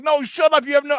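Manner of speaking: high, loud retold yell; exasperated shout-down, retold. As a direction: yell it high, re-enacting your bar outburst. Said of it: "I'm yelling at people like".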